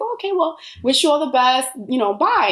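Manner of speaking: a hint of an upbeat man saying bye; cheery goodbye.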